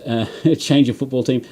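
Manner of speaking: normal talking voice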